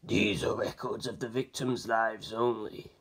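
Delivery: low, soft, slow reply; flat dark murmur, unhurried; Abaccio, low and quiet. A narrator's reading. Abaccio answering the detective, low and slow. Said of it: "Abaccio shook his head".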